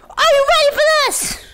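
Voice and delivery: high, loud hyped shout; hype shout. Real, not acted. Streamer hyping chat before the flip, high loud shout. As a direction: pump the crowd up.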